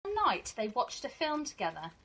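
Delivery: teacher reading to her class, gentle and expressive; storybook reading. Acted. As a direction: read it like a teacher sharing a picture book with her class. Reads picture book to pupils.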